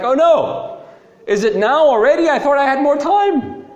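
high, loud, hammed-up alarm; mock panic. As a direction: wail it like a panicking demon caught out. Acting the demon's panic before Jesus.

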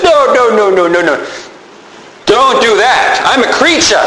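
protesting angel. Acted. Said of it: "and the angel says".

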